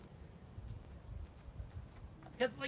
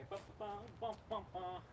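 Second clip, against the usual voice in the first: soft, nonchalant "bum bum" ditty; casual hummed bum-bum; 8 dB quieter than usual. Retold or imitated. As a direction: toss off little dancing noises, softly. Fencer dancing along, unbothered.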